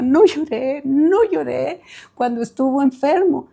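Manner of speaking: put-on tearful child's plea, pitch climbing; imitated crying. Boy telling mother he kept his promise, imitated crying. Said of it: "por qué lloraba".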